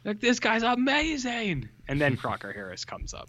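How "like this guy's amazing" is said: high, slow gushing cheer; mock excited kids. Podcast host voicing cheering schoolboys, high and gushing. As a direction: gush over him like a wowed kid.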